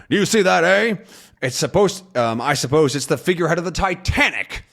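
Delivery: a touch of a needling captain; Haddock voice. Acted. Haddock pointing out the figurehead to Calculus, mocking. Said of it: "and he says".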